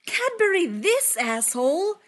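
high, loud, slow battle whoop; whooping yell. Acted. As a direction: yell it out gleefully. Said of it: "Clint whoops and yells".

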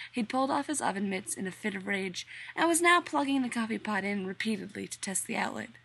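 ordinary delivery

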